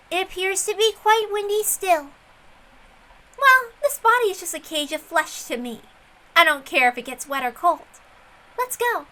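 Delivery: a hint of the haughty girl detective; Erica. Erica's lines in the story.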